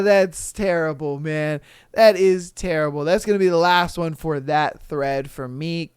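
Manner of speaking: usual speaking voice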